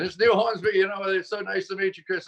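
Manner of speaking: high, badly faked British accent; Neil Hornsby. Guest imitating the polite British caller, badly faked British accent. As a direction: have another go at a British accent, pitched high. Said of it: "I can't do a British accent".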